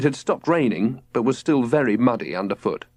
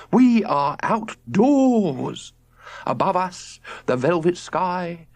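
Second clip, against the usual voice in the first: slow, higher dreamy nature speech; rhapsodic father; 7 semitones higher, slower than usual. A narrator's reading. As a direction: paint the night sky lovingly. George rhapsodizing.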